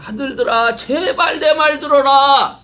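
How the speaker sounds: high, loud, slow pleading to his sons; a wise father begging his sons to listen. Acted. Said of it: "지혜자는 뭐라고 말하느냐".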